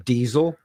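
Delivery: "diesel" with a long ee; DEE-zel, clear long ee; long EE vowel. Shown as a demonstration. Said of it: "The second vowel is long".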